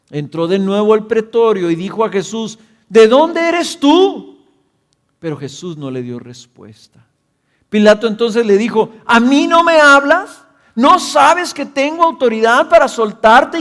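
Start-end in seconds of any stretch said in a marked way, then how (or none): 2.9-4.4 high, loud, slow frightened asking; alarmed governor pleading for an answer; fearful question. A narrator's reading. Frightened Pilato questions Jesus. 9.1-13.6 high, loud indignant challenge; indignant demand. A narrator's reading. Pilato confronts silent Jesus.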